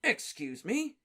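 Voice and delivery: high, disbelieving question; Diggory doubting Harry; incredulous. Acted. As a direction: ask in disbelief. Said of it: "said Mister Diggory incredulously".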